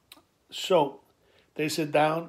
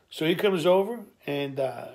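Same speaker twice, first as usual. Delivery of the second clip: ordinary delivery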